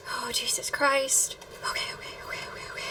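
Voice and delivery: breathy whispered self-soothing; overwhelmed whisper. Real, not acted. Viewer trying not to cry over a scene, overwhelmed whisper. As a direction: whisper it, trying to hold back tears.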